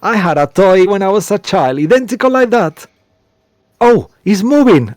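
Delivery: high, loud excited squeal; thrilled woman seeing a toy dance; excited delight. Acted. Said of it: "she starts to laugh".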